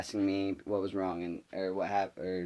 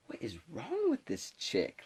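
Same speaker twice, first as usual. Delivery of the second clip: high, slow, softer incredulous question; acted disbelief; 7 semitones higher, 7 dB quieter than usual. Students reacting to the substitute, incredulous. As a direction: marvel in hushed exasperation.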